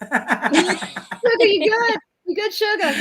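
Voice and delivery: high, sweet Southern drawl with a laugh; Southern accent. Pretend. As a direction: coo it like a Southern auntie. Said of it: "imagine him with the Southern accent".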